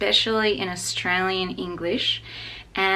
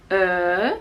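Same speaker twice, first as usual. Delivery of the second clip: ordinary delivery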